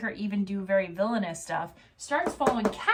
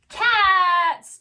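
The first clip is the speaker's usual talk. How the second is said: high, loud, drawn-out reveal; big game-show style reveal; announcing shout; 10 semitones higher, 9 dB louder than usual. Pretend. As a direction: shout the name and stretch it out. Dramatic reveal of the secret storyline.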